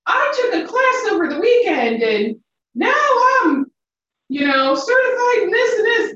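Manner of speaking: high, exaggerated show-off voice; mocking overeager novices. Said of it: "some of these people who are like".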